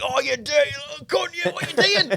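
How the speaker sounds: very high, outraged comic voice. Retold or imitated. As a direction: squawk it in scandalised disbelief. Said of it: "My inner [name] came out".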